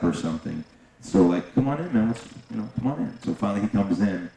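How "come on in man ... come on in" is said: higher, coaxing come on in; coaxing invitation. Retold or imitated. Inviting the pacing agent inside, coaxing. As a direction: coax a nervous guest inside.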